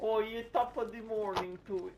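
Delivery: high, bad stage-English voice; a mock English toff accent, badly done.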